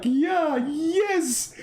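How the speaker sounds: high, gushing mock excitement; mock excited. Mosque crowd cheering the promise, high mock excitement. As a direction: ham up a thrilled worshipper. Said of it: "The guy is so excited".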